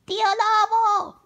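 put-on high, drawn-out girlish call; girl's shout. A narrator's reading. Carmela calling at the wolf's door, high shout. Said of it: "gritó Carmela".

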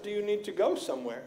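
soft, calm polite question; gentle, hushed polite question; polite quiet question. Retold or imitated. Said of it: "I said very politely".

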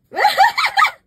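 real laughing shriek at the sight; laughing scream; burst of laughter. Real, not acted. Shocked at own belly.

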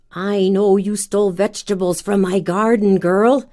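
lightly voiced angry witch; a hint of a yelling old witch. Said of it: "yelled the ugly old witch".

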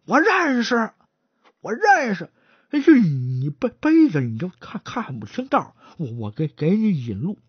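stammering, tripping over every word; a nervous stutterer begging to lead the way. Acted. 结巴的伙计结结巴巴地求着带路. Stuttering porter voiced in story.

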